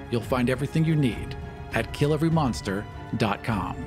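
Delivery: usual speaking voice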